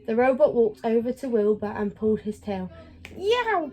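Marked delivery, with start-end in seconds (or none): 3.2-3.7 high yelping cat cry; cat yowl. Acted. Wilbur yelps as the robot pulls his tail, high cat cry. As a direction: yelp like a cat with its tail pulled. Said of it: "cried Wilbur".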